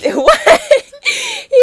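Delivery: can't get the words out for giggling; laughing. Real, not acted. Cracking up while recapping the episode to a friend, laughing.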